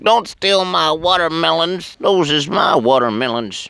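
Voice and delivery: high, put-on protesting little monkey; hammed-up high creature voice; cartoon monkey.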